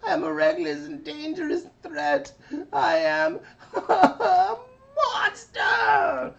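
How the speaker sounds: very high, loud wailing cartoon voice; high hysterical little kid meltdown; Carbonate voice. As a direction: wail it out, building to a crying shout. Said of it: "he's going".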